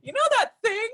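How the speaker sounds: high, giggling sheepish question; sheepish laugh. Retold or imitated. Phoning Sherry to admit interest, high sheepish giggling. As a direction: laugh through it, a bit embarrassed. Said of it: "I phoned Sherry and I said".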